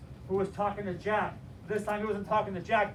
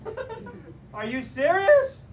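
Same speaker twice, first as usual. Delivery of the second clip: higher, louder, slowed "are you serious"; shocked disbelief; 7 semitones higher, 6 dB louder than usual. Retold or imitated. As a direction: act stunned with a laugh breaking in. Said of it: "He said".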